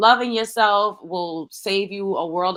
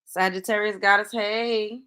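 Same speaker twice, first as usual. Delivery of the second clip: ordinary talk, nothing marked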